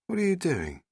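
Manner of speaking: high questioning lift; ask, trying to move on; Rose, curious. Acted. Rose asking the Doctor at the console, curious.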